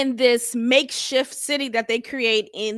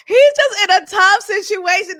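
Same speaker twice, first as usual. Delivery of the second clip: laughing; 7 semitones higher, 7 dB louder than usual. Real, not acted. Laughing through the quote.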